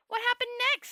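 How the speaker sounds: high, loud eager question; excited breathless asking; breathless excitement. A narrator's reading.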